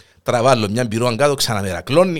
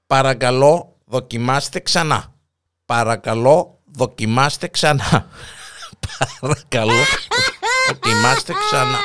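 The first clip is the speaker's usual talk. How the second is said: robot announcement voice. Retold or imitated. Robot tells the old man to try again, automated voice. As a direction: recite it like an automated system message. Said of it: "ακούεις το ρομπότ να λέει".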